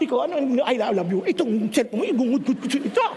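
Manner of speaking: low, menacing parent voice; mock angry parent. Parent confronting child over texts, in a low threatening mock voice. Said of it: "ganito kaagad".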